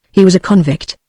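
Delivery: ordinary delivery, nothing marked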